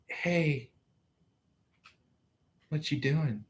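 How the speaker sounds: quiet casual hey; soft casual greeting. Pretend. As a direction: say it gently, offhand. Twin flame's imagined casual greeting.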